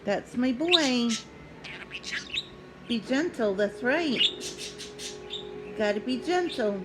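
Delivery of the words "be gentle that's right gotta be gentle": normal talking voice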